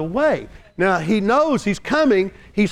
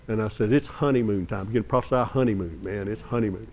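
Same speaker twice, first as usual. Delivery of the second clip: much lower and softer, hushed; tender retelling; 11 semitones lower, 5 dB quieter than usual. Real, not acted. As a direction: draw them in quietly. Tender retelling of couple weeping.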